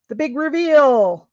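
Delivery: slow, loud reveal; excited announcement. Real, not acted. Crafter unveils her dyed piece to viewers, slow and loud. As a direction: build it up like a showman.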